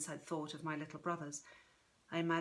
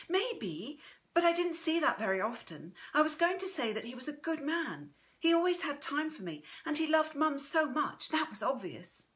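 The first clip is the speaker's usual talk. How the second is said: higher-pitched, earnest granddaughter; higher voice, fondly insistent; Chrissy, earnest; 7 semitones higher than usual. Acted. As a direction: speak up higher in loyal defence of him.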